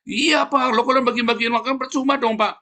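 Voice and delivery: high-pitched, naive protesting voice; church worker's reply. Retold or imitated. Church worker defends giving out verses, high and naive.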